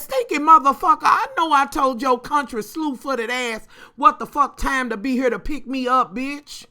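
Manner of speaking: loud cussing tirade at the late driver; auntie cussing. Retold or imitated. Auntie cussing out the late driver, loud and furious. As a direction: tear into him for being late. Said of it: "and she says".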